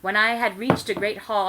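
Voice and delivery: plain reading voice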